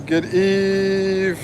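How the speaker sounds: ordinary talk, nothing marked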